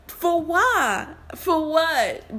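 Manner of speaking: incredulous. Real, not acted. Incredulous rhetorical question.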